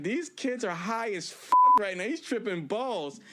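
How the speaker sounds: slow, high, loud, laughing through it; laughing at the stoned kids on screen; laughing disbelief. Retold or imitated. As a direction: crack up at how high they are. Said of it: "I'm losing my shit 'cause I'm like".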